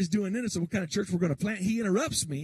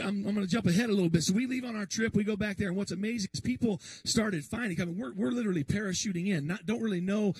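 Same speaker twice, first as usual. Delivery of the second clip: normal talking voice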